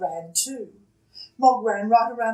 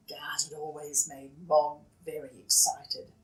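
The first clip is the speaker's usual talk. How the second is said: whisper; 6 semitones lower, 8 dB quieter than usual. A narrator's reading. Narrator drops to whisper.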